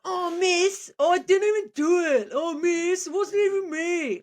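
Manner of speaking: high, loud schoolboy whine; whingy teen boy. Shown as a demonstration. Teen boy denying blame to teacher, whingy voice. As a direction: whinge it like an accused teenager. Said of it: "voice of a whingy, uh, teen boy".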